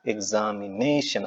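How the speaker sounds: Examination slowly, with നേ drawn out; stress on NE. Shown as a demonstration. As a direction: stretch examination out, leaning on the syllable നേ. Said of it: "ഏത് syllable ആണ് നമ്മൾ stress".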